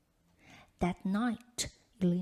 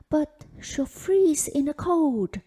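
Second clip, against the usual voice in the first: higher, louder, pleading for his daughter; frightened cry; 6 semitones higher, 9 dB louder than usual. A narrator's reading. Father begging the stepmother, alarmed cry. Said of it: "cried Elena's father".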